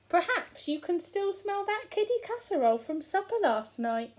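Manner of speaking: put-on a wife covering for the boy; a hint of a sly giant wife; wife's voice. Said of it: "wife's line quoted to giant".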